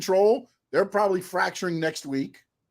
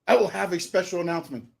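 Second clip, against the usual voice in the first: lightly mocked stiff announcer, softer; imitating TK; 3 semitones lower, 4 dB quieter than usual. Co-host mocking TK announcing Rampage, lightly stiff. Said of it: "He's like, he's like".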